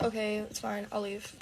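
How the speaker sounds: imitating boyfriend. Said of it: "He's like".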